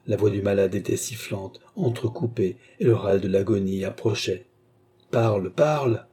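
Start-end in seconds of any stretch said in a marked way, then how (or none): 5.1-6.1 insistent command, mildly raised; imperious. A narrator's reading. Bastien demands the dying man's account, imperious. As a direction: order him to talk, firmly. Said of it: "s'écria Bastien d'un ton impérieux".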